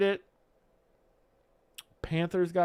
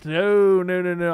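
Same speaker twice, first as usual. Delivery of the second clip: loud no; 2 semitones higher, 9 dB louder, slower than usual. Real, not acted. Rejecting own joke.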